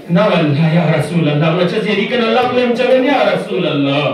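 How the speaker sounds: high, forceful sermon cry; impassioned pledge. Acted. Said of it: "مسلم ابن عو سجد اصحاب کنی بریان".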